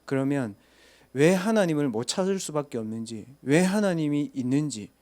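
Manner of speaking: usual speaking voice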